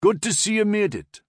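high, loud Geordie greeting; broad Newcastle accent. A narrator's reading. Corporal glad the major made it, calling in Newcastle accent. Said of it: "in a broad Newcastle accent".

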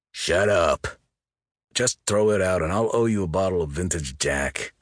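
loud angry snarl; growled. A narrator's reading. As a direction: bark it at him gruffly. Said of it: "Moot growled".

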